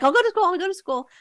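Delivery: put-on high, fast frantic pleading of a scared five-year-old; panicked kid. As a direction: beg in a rush, way up high. Son begs in front of officers.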